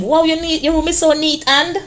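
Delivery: a hint of a delighted mother; mommy praising. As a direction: praise the child like a proud mom. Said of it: "your mommy said".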